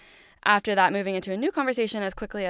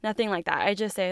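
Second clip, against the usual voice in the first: ordinary talk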